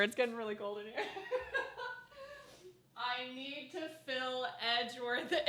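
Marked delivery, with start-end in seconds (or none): 3.0-5.5 soft, helpless giggle while speaking; giggling. Real, not acted. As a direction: barely get it out for laughing, quietly. Still giggling at game line.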